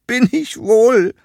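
high, loud weepy protest; sobbing. A narrator's reading. Hedgehog contradicting the fire toad, sobbing. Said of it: "schluchzte der Igel".

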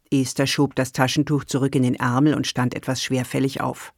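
plain reading voice

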